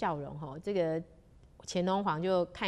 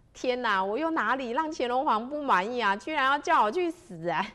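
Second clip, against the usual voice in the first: higher, louder alarmed inner cry; dismayed; 6 semitones higher, 6 dB louder than usual. A narrator's reading. Minister thinking about the emperor's death order, dismayed. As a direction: voice his disbelief with rising force.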